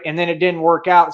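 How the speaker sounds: ordinary delivery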